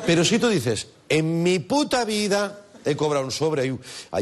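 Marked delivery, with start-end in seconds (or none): 1.1-3.7 emphatic, higher-pitched vow with punch on "puta"; forceful swearing. Shown as a demonstration. Comic showing how a swearword makes denials convincing, forceful. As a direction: hammer "puta" to sound utterly believable. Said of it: "Pero qué contundente".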